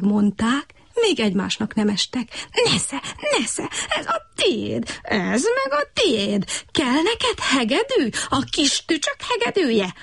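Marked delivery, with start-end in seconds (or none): 2.6-9.9 high, slow mock frog yell; a frog taunting while fighting. A narrator's reading. Brekeki yells at Kutykurutyki while fighting, high frog voice. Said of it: "ordította Brekeki".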